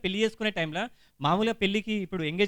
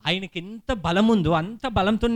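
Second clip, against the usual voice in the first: ordinary talk, nothing marked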